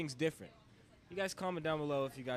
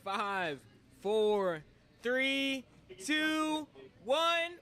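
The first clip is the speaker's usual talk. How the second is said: building louder and higher each number; building countdown; 11 semitones higher, 9 dB louder, much slower than usual. Real, not acted. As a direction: count down like an announcer building hype. Hosts countdown to round start.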